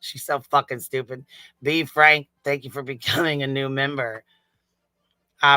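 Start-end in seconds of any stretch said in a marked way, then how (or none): none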